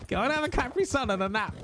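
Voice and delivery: teasing mum voice; mock parental scolding. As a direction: talk to them like a tired parent with a cranky kid. Telling an overexcited chatter to rest.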